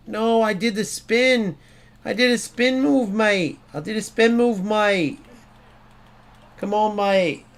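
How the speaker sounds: high, whining English protest; mock English accent, exasperated; put-on English accent. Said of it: "That's my English accent".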